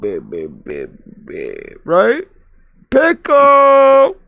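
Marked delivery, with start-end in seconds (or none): 2.9-4.2 calling out. Real, not acted. Calling out to Pickle.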